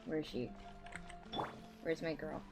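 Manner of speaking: normal talking voice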